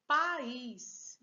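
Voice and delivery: país loud, stretched as pa-ís; separated vowels. Shown as a demonstration. Teacher showing two openings of the mouth, país split. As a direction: open twice on país, pa-ís. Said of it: "abri a boca duas vezes".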